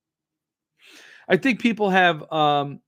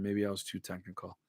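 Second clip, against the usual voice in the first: much softer, muttered to himself; quiet mutter; 8 semitones lower, 15 dB quieter than usual. Real, not acted. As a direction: mumble it under your breath. Repeats own remark, second time muttered.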